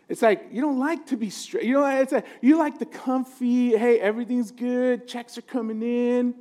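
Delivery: ordinary delivery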